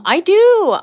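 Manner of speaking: high, loud, enthusiastic burst; eager admission. Real, not acted. Streamer answering a viewer about boys love, gleefully eager. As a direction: sing out the yes with glee.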